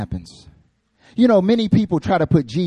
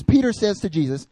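normal talking voice

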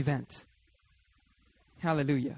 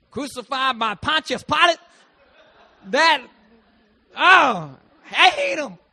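mock hate; 7 semitones higher, 9 dB louder, slower than usual. Mocking congregation hating Pilate.

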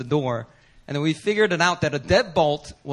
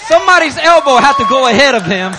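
loud, high preaching shout; 10 semitones higher, 9 dB louder than usual. Real, not acted. Pastor driving the point home to the congregation, loud preaching. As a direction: proclaim it to the room.

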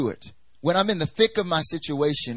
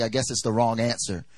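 normal talking voice

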